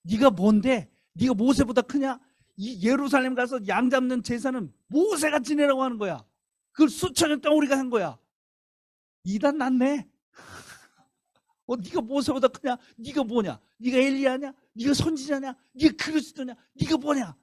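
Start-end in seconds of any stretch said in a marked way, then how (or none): 0.0-8.2 higher, slow, indignant challenge; heated retort. Retold or imitated. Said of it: "말이 험악해지는 거지". 11.7-17.4 higher, slow, indignant challenge; heated retort. Retold or imitated. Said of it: "말이 험악해지는 거지".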